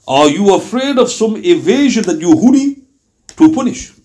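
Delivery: formal, insistent questioning of the Lord; reproachful prayer read aloud. Acted. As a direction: read out a bold plea, pressing each question. Reads out a bold prayer of reproach.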